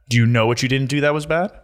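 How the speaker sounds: ordinary delivery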